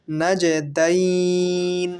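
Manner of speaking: "নাজে দাইন" intoned slowly, "দাইন" held long; madd lin elongation. Shown as a demonstration. Teacher showing madd lin, "দাইন" drawn out. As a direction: stretch "দাইন" on a level note into the ন. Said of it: "এখানেও এক আলিফ মাদ্দে লিন".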